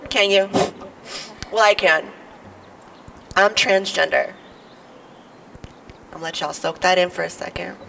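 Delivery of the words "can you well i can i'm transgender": ordinary talk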